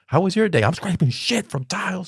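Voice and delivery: exasperated parent, played for laughs; exasperated retold exchange. Acting out exchange with wife.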